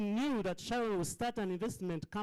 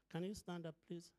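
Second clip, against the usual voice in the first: normal talking voice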